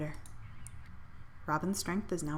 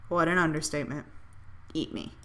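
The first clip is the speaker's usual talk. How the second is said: plain reading voice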